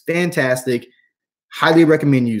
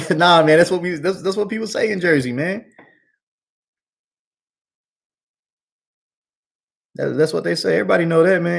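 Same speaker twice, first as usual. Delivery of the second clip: laughing high; 7 semitones higher than usual. Real, not acted. Laughs off the dad joke.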